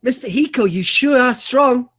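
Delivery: high, slow, breathy mock flirt; seductive wife. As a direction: drag it out like a swooning admirer.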